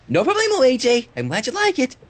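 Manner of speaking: high, cheerful pony voice; a hint of chirpy Pinkie; lightly voiced Pinkie. A narrator's reading. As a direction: bounce the line brightly. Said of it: "Pinky replies".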